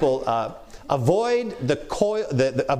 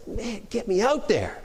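higher, slower, eager to get up and preach; straining to get on stage, higher and slow; 6 semitones higher than usual. Retold or imitated. Preacher behind the curtain itching to go, eager and higher. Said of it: "I'm just like".

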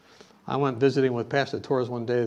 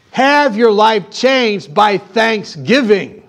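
louder, pounding the call to give thanks; preaching at full force; 4 semitones higher, 8 dB louder than usual. Acted. Pastor urging the congregation to give thanks, preaching loud.

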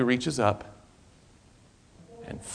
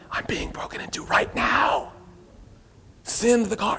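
urgent hushed whisper into the phone; panicked whisper; 8 semitones higher, 6 dB quieter than usual. Acted. Homeowner hiding from burglar, whispering to 911 dispatcher. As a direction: whisper fast so the burglar won't hear.

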